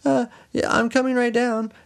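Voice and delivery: very high, called-out reassurance; high-pitched acted wife; stalling wife. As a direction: call down sweetly to buy time.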